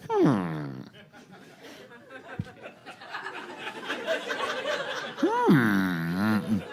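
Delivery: low, drawn-out thoughtful hmm; mock pondering hum. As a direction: hum like a fussy diner studying the menu. Hipsters pondering KFC menu.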